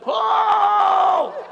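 high loud laughing whoop; high delighted laughing cry; laughing shout. Real, not acted. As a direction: let out a joyful whoop. Laughing shout at his own story.

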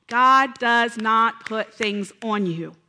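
slow, loud, each word landed; slow punched statement of faith; emphatic declaration. Real, not acted. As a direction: hammer home that God does not do this. Emphatic preaching point.

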